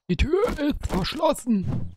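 high, slow sing-song voice copying the game; mock game voice. Gamer mimics the locked-door message, high and drawn out. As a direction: stretch out "Die Tür ist verschlossen" high and slow like the game character.

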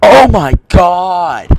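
high, drawn-out wail of outrage; frustrated shout. Real, not acted. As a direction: shout it slowly in exasperation. Killed in the game.

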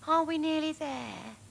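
put-on a bored kid pestering from the back seat; whiny child. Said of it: "Matthew kept saying".

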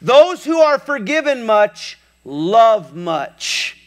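high, loud preaching of it; preached loud. Retold or imitated. Jesus declaring to everybody, loud preaching. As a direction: proclaim it to the whole room. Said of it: "he says something profound to everybody".

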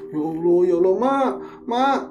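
put-on desperate child begging dad to get up; panicked pleading. Boy pleading parents to wake.

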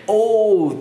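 オー drawn out as a round "oh". Shown as a demonstration. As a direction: make オー long and round. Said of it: "音を丸く出すんですよね". (丸く出す「オー」)

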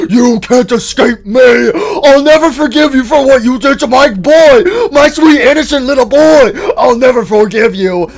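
high, loud vengeful shouting; enraged villain. Acted. Character vowing revenge for his boy, enraged villain shout. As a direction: roar it like a wronged parent. Villain figure threatens from outside.

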